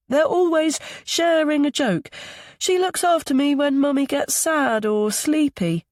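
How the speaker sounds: put-on very high child character voice; small boy's high voice. A narrator's reading. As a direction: pipe up like a little boy. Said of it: "Milo's high voice".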